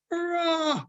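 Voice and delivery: a thin, high, weak little cheer; feeble cry. Acted. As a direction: cheer feebly like a tired child, pitched high. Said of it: "feebly cried".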